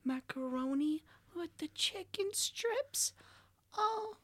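high, soft meme impression; high-pitched mock moaning delight; imitating Vine voice. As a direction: mimic the viral clip, high and hushed. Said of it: "you ever hear the Vine with the".

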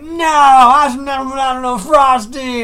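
put-on high, slow wailing toddler; imitated garbled tantrum cry; mock crying. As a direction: drag it out in a loud bawl. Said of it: "Stop crying so I can understand you".

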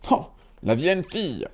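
high-pitched dwarf tutting; dwarf scolding the rude girl, high. A narrator's reading. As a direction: cluck disapprovingly like a little dwarf. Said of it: "dit un des nains".

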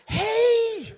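high, loud, laughing fake hello; fake cheerful hey. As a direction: pretend you know them, brightly. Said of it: "when you're doing that".

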